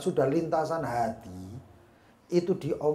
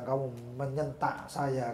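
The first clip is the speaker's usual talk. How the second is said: ordinary delivery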